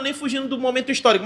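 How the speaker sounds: ordinary talk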